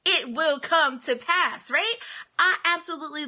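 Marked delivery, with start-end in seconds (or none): 0.0-1.7 slow, louder proclamation; David's proclamation. Retold or imitated. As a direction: declare it slowly like a psalmist. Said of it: "how David would say it".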